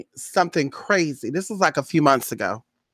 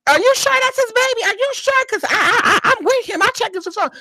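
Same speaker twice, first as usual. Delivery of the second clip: high, squeaky put-on voice of an upset girlfriend; hammed-up side piece demanding answers; mock confrontational woman. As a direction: voice her high and accusing. Acting out fighting women.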